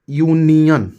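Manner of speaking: teacher to students, saying यूनियन clearly; example word said out. Shown as a demonstration. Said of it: "जैसे".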